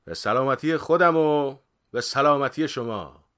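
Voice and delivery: loud, high, slow toast; drawn-out, declaimed toast; jovial toast. A narrator's reading. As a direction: raise a glass to the whole room. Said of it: "بغوث خندید و گفت".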